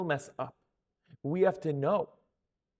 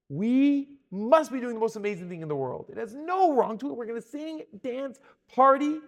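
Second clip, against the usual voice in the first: higher, emphatic party talk; mock self-justifying elation; 5 semitones higher, 5 dB louder than usual. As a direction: imitate a crowd talking itself into celebrating. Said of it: "they took the other road and said".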